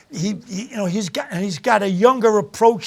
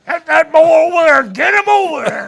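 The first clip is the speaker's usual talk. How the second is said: high, loud barking of orders, a bit hammed-up; mock shouted orders; 9 semitones higher, 8 dB louder than usual. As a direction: holler like a wagon driver rounding up kids. Voicing someone hauling him off.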